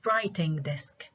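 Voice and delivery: WRIting desk, weight on WRI; first-syllable stress. Shown as a demonstration. Teacher showing where compound stress falls, first-syllable stress. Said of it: "هو المقطع الأول".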